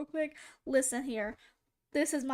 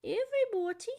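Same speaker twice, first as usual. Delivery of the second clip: posh British woman; 8 semitones higher, 4 dB louder than usual. Retold or imitated. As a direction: stretch it out like a prim British lady. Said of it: "who's that sound like".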